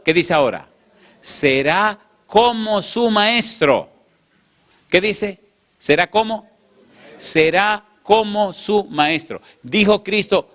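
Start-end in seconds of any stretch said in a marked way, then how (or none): 1.2-9.4 slow, high, drawn-out emphasis; emphatic. Real, not acted. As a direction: hammer home each word. Preacher stresses the verse. (recalcando despacio y alto)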